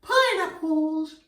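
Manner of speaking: very high, slow and stretched; high silly fruit voice for kids; squeaky high pineapple voice. Shown as a demonstration. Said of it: "a voice for the pineapple".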